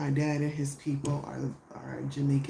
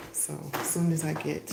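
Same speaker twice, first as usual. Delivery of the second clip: ordinary delivery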